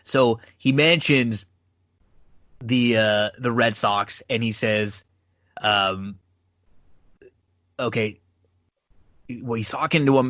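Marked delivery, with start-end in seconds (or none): none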